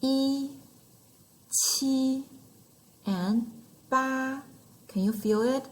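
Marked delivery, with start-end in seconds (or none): 0.0-2.2 "E, qi" each held high and level; first tone, high flat. Shown as a demonstration. Teacher grouping first-tone numbers for learners, high and flat. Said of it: "The tone is high and flat". 3.9-4.4 "ba" held high and level; first tone, high flat. Shown as a demonstration. Teacher showing learners the first tone on number eight, high and flat. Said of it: "The tone is high and flat".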